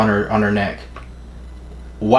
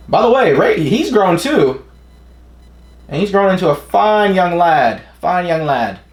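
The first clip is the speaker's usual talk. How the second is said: playful admiration; 7 semitones higher than usual. Real, not acted. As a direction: gush over him in a playful high voice. Playful gushing over grown-up character.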